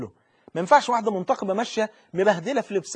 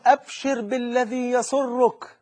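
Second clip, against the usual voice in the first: slow, drawn-out and raised, like a herald; solemn announcement; 6 semitones higher, 4 dB louder, much slower than usual. Acted. Angel announcing joy to the dead believer, slow and solemn. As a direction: proclaim the good news slowly and grandly.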